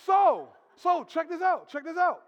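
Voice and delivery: loud, slow preacher's emphasis; rising sermon cadence; full-force preaching. Real, not acted.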